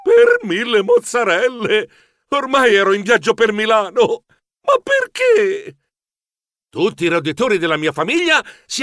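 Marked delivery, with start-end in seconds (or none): 0.0-5.8 dismayed exclamation. A narrator's reading. Mouse hero hauled off to Milan, comic dismay. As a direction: exclaim in mock despair, climbing very high.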